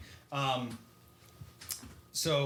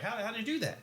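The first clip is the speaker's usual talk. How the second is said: much higher, amazed question; asking in disbelief, pitched way up; 10 semitones higher, faster than usual. Retold or imitated. Saul asks in amazement.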